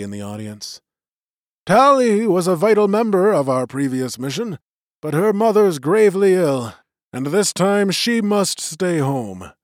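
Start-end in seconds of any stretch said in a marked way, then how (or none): 1.7-9.5 lightly voiced elder, raised and formal; magician's voice. A narrator's reading. Old magician opening the vote to the clan, lightly voiced and raised. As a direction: address the gathering like a presiding elder. Magician announces Tali stays.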